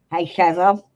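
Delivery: a light Aussie twang on the greeting; Aussie accent. Pretend. Offering chat viewers an Aussie greeting, light Aussie accent. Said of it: "Do you want me to talk like an Aussie?".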